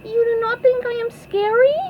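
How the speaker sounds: very high, squeaky question; plaintive cartoon squeak, rising at the end; SpongeBob voice. A narrator's reading. SpongeBob asks the Flying Dutchman, high hurt squeak. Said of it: "asked SpongeBob".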